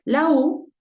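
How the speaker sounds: ordinary delivery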